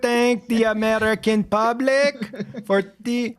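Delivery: put-on Melania thanking the public in a Borat accent; Borat-style Melania. Mock Melania speech to the American public in a Borat accent. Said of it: "that's a good Borat".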